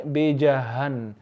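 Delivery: बीजहन very softly, with a light h; low, quiet बीजहन, easy breathed h. Shown as a demonstration. Teacher repeats the correction, बीजहन with soft h. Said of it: "ये हा soft होना चाहिए".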